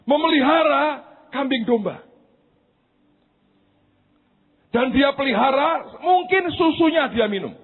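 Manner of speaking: high, loud sermon climax; preaching at full force. Acted. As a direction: preach it out with fire. (khotbah berapi-api, keras dan tinggi)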